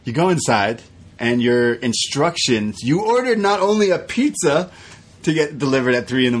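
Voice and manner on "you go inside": ordinary talk, nothing marked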